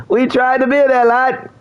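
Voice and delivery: high, laughing through the words. Real, not acted. Streamer laughing at terrible gear, high and giggling. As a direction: crack up as you say it.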